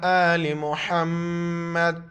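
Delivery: slow, drawn-out chanted blessing; slow recitation. Real, not acted. Teacher opening the lesson, slow chanted blessing. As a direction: intone the durood slowly and devoutly.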